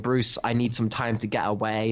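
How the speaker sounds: ordinary talk, nothing marked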